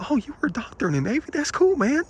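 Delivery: high, quick impressed reaction; friendly interest. Retold or imitated. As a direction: retell being pleasantly impressed, pitch rising. Retells his friendly, impressed reply.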